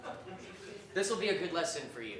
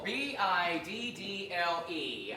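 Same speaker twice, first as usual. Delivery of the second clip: letters spelled out slowly; spelling slowly; much slower than usual. Shown as a demonstration. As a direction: spell each letter clearly. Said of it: "spelling the name".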